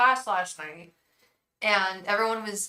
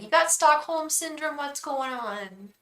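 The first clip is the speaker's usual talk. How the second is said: teasing; 6 semitones higher, 5 dB louder, slower than usual. Retold or imitated. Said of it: "I'm like".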